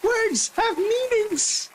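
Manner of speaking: very high, drawn-out mock echo; high-pitched mimicry. Viewer echoing the comedian's line, high slow mimicry. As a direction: squeak it out slowly, mimicking the comedian.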